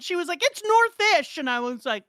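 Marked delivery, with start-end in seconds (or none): 0.4-1.4 high, loud mock protest; defensive insistence. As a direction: imitate a pupil digging in, pitched way up. Acts out student's defensive reply.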